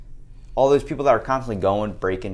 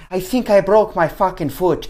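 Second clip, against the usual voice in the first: high, loud mock-Irish lilt; Irish accent; 8 semitones higher, 5 dB louder than usual. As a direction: imitate a hurt Irishman on the radio. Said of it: "talking like him with an accent".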